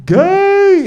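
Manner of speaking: mock shouted insult. Student heckling the comic, high loud yell.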